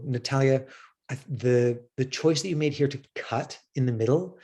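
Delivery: usual speaking voice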